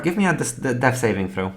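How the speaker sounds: ordinary delivery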